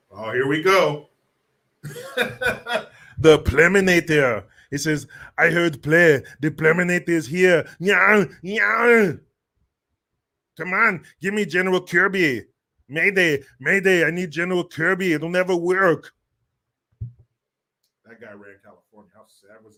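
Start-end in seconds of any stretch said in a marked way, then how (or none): 3.2-4.4 loud hammed-up Austrian accent; mock Austrian accent. Announcing the Pleminator to listeners, mock Austrian accent. Schwarzenegger impression of a nickname. 5.4-16.1 loud hammed-up Austrian action-hero accent; mock Austrian accent. Action hero calling mayday for the general, mock Austrian accent.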